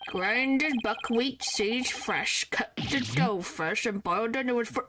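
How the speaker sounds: put-on slow, slurred drunk voice; tipsy character, words stretched out; drunkard. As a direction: drag and slur the words like a drunk. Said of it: "do this voice with the frickin' drunkard".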